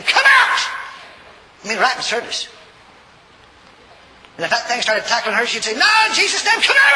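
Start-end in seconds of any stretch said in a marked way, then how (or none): 0.0-0.6 high, loud imitated command; shouted exorcism command. Preacher imitates helper casting out a devil, shouted command. Acting woman commanding a demon out. 5.7-6.9 high shout rising to the command; shouted exorcism rebuke. Retold or imitated. Helper tackled by the demon, shouting it back out.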